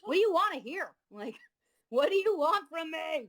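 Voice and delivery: high, loud, fed-up comeback; exasperated retort. Retold or imitated. Quoting her retort to the dismissive band leader, loud and exasperated. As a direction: throw the question back at him, indignant.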